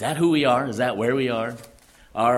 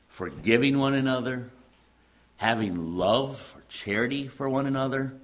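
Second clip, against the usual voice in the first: ordinary talk, nothing marked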